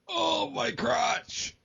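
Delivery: high, drawn-out pained moan; mock groin pain. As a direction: groan it out as if hit below the belt. Imitating man hit in groin.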